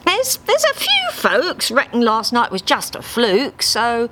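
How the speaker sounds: hesitant, sheepish and high; a little guiltily. Acted. Harry admitting Dave's poor odds, a touch guilty. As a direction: hedge it, avoiding the bad news. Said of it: "a little guiltily".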